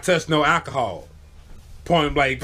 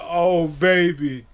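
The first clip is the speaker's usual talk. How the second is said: mock weepy; 4 semitones higher, 3 dB louder, much slower than usual. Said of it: "he was like".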